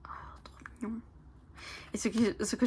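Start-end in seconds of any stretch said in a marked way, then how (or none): 0.0-1.0 soft whispered delight; awed whisper. Real, not acted. Charmed by a card drawing, delighted whisper. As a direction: breathe it out in a hush.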